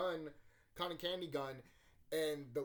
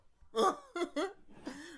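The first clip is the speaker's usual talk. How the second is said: big high-pitched burst of laughter; loud high laugh; 8 dB louder than usual. Real, not acted. Laughing at own boast.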